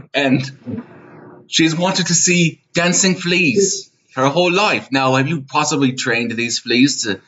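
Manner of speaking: hammed-up father begging a favour; doting vendor. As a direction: plead sweetly on the daughter's behalf. Improvised Renaissance fair scene.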